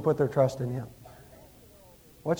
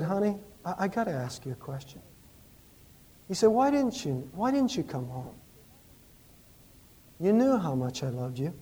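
soft questioning; 7 dB quieter than usual. Retold or imitated. Husband asking wife quietly at night.

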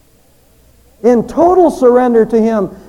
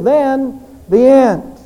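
"end" drawn out slowly and landed with a fall; slow weighty "Then the end", leaning on "end"; emphatic Then the end; much slower than usual. Shown as a demonstration. Preacher to congregation, slow emphatic "Then the end". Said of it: "with emphasis in the Greek".

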